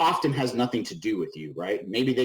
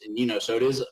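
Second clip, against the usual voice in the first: usual speaking voice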